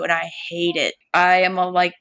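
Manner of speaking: normal talking voice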